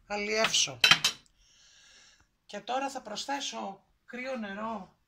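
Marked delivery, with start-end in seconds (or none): none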